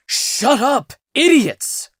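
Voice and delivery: shrill high yell at his friends; frantic cry. Acted. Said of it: "cried Vamenos".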